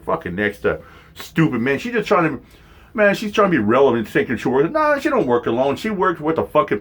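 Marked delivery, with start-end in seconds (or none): none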